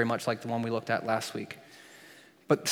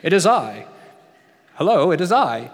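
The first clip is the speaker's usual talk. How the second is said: loud, higher, grandly theatrical; pompous mock greeting; 4 semitones higher, 9 dB louder than usual. Preacher daring the congregation, hammed-up grand announcement. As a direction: announce yourself like a hammed-up hero. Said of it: "try to use that in casual conversation".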